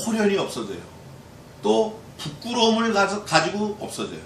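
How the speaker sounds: normal talking voice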